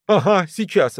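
dismissive retort. A narrator's reading. Brushes off the urging to leave.

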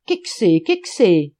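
loud, puzzled call in dialect; shouted question. A narrator's reading. Man across the Moselle not understanding, shouted question. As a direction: holler it twice across the water. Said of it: "cria dans son patois de Trèves".

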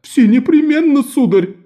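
high, hurried, frightened yes; hasty frightened reply. A narrator's reading. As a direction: scramble to agree. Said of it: "ответил торговец и торопливо".

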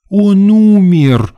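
high, loud, long-drawn wail; overacted tragic actress; pathetic exclamation. A narrator's reading. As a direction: stretch it out with full pathos. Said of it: "патетично воскликнула дама".

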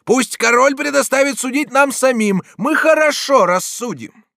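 high, loud retort; defiant shout. A narrator's reading. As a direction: shout back at authority. Attacker defies the judge. (дерзкий громкий крик)

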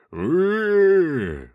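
high, loud drawn-out demon roar; demonic roar. Acted. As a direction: let out a monster's bellow. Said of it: "заревел демон".

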